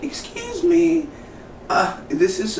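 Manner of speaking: high, drawn-out put-on voice; imitated interrupting neighbor. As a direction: stretch it out high, mimicking a neighbor butting in.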